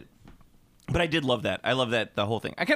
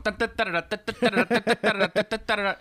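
rapid-fire high "da-da-da" noises; imitated fight noises; 8 semitones higher than usual. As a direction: mimic frantic fight action with staccato syllables. Imitates jumping-around fight noises.

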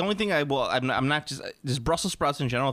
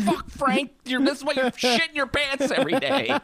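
laughing mock scold; 9 semitones higher, 3 dB louder than usual. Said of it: "And you're like".

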